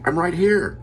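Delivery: put-on high, loud kid voice "I'm right here"; kid's answer. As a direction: pipe up like a cheeky kid. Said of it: "And I would say".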